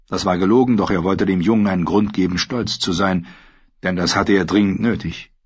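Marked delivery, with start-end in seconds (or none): none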